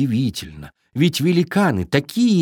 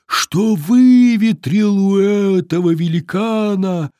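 slow, louder windy voice; droning wind; 6 semitones higher, 4 dB louder, much slower than usual. A narrator's reading. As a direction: stretch the words like wind. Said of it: "прогудел ветер".

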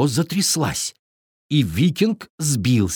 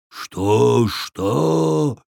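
lightly voiced rumbling giant; booming giant. A narrator's reading. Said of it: "прогрохотал он".

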